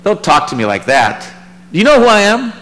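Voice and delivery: mock offended authority; indignant. Imagined God rebuking Jonah, indignant and rising. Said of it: "Now, you go".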